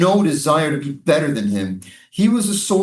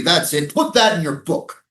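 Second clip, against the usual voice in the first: ordinary talk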